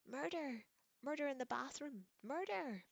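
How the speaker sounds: very high, loud screaming. Acted. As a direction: scream it like a ghost raising alarm. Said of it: "let out a deafening scream".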